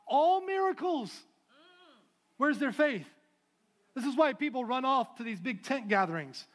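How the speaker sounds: loud, driving preacher's cadence; full-force preaching. Acted. Pastor preaching to the congregation, loud and driving.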